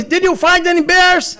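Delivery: a mock-serious officer ribbing him; teasing officer question. Officer meeting him again asks about bears, teasing. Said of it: "E ele olhou pra mim".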